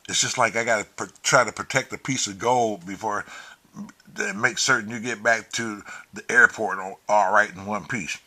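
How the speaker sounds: ordinary delivery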